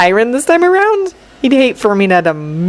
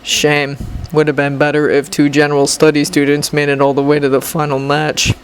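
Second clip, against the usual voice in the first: low, cool rival voice; low, unimpressed rival; low boy voice; 9 semitones lower than usual. Acted. Shinso's voice in story.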